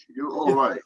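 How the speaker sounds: ordinary delivery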